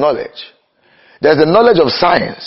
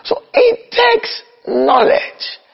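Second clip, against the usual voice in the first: very high, slow, ringing pulpit call; high preaching cry; much slower than usual. Acted. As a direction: lift it into a soaring sermon cry. Preaching climax.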